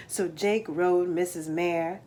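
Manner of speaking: plain reading voice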